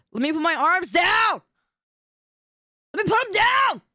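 pleading shout. Real, not acted. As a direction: yell to be allowed to drop the arms. Begging to lower arms.

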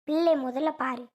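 ordinary talk, nothing marked

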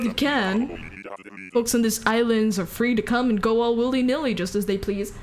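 ordinary delivery

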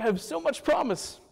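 smug self-praise, tone lifted; self-satisfied. Retold or imitated. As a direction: boast about yourself playfully. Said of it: "I'm like".

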